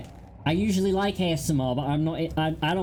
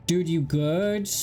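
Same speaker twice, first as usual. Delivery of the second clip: normal talking voice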